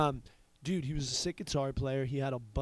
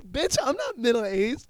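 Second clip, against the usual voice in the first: loud, higher indignant protest; mock-offended shout; indignant yell; 10 semitones higher, 8 dB louder than usual. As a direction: fire it back at her, offended. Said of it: "I'm like".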